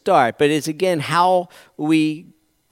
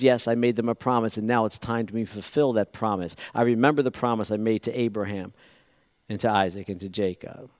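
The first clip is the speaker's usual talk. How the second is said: softer, unhurried promise; calm voice of God; 6 semitones lower, 8 dB quieter than usual. Retold or imitated. Preacher voices God recalling his promise to Abraham, soft and calm. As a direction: speak it gently, like a faithful promise kept.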